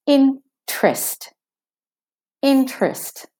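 "Interest" as IN-trest, no er; two-syllable interest. Shown as a demonstration. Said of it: "as if the er isn't there".

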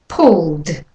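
Pulled, L straight into D; pulled with a final d. Shown as a demonstration. Teacher repeats the word for practice. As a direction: model Pulled slowly for copying. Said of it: "Have a practice yourself".

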